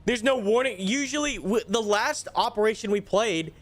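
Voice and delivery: high, worked-up ranting; agitated complaint. Real, not acted. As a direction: vent it all in one high rush. Complaining after the lost mission.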